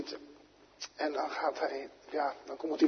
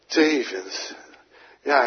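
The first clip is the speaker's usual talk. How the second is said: ordinary talk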